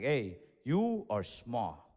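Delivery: low, very slow, spaced-out verdict; blunt grandparents. Retold or imitated. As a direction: break it to him slowly and low, word by word. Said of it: "They would always tell me, they're like".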